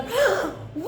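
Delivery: high gasp of amazement; sharp, excited intake of breath; astonished gasp. Acted. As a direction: gasp at wonderful news. Monkey amazed by dinner invitation.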